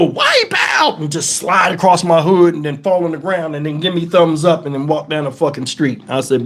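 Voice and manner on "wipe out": high-pitched mock holler; imitating a homeless man's cry; imitated call-out. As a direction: call it out like a wild stuntman. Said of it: "Homeless dude come up and go".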